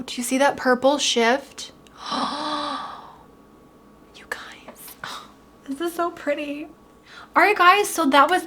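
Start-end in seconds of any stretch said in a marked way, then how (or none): 2.0-2.9 soft gasp. Real, not acted. Sees the purple shift. 4.1-5.7 breathy whisper, almost no voice; awed whisper. Real, not acted. Awed at the shimmer. 5.7-6.7 awed gasp. Real, not acted. Awed at the shimmer.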